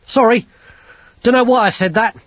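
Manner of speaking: high, loud flustered apology; nervous apology. Acted. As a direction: blurt it out, rattled. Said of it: "He coughed nervously".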